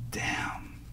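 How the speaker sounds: whispered, stunned; retold hushed amazement; awed whisper. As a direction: let it out under your breath in awe.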